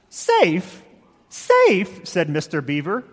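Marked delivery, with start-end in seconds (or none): 0.1-2.1 put-on incredulous high repeat, climbing, in English accent; hammy, astonished English-accent exclamation; horrible English accent. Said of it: "my horrible English accent".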